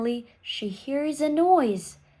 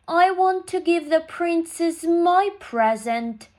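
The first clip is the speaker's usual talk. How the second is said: higher, louder fairy-tale villain; angry bad fairy; 6 semitones higher, 5 dB louder than usual. A narrator's reading. Bad fairy angry at not being asked to the party, higher and louder. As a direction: announce it as the wicked fairy. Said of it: "The bad fairy is angry".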